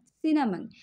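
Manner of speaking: सिनामन ending in a short मन; correct pronunciation. Shown as a demonstration. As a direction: model सिनामन with a short final मन. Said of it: "इसको आप बोलेंगे मन".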